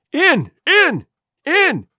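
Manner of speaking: high, loud repeated command; enraged shout. A narrator's reading. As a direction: shout each word as a separate burst. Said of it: "he shouted".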